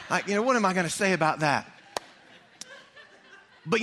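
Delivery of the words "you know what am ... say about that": ordinary talk, nothing marked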